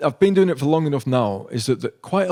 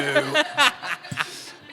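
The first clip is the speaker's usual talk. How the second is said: high laugh; 10 semitones higher than usual. Real, not acted. After his own joke.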